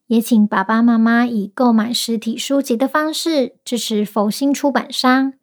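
ordinary delivery, nothing marked

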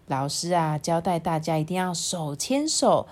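ordinary delivery, nothing marked

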